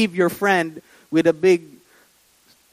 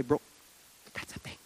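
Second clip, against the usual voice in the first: barely audible, voiceless whisper; discreet whisper; 4 semitones lower, 17 dB quieter, much faster than usual. Retold or imitated. Friend tipping off a close friend, hushed whisper. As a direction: whisper it privately to a buddy.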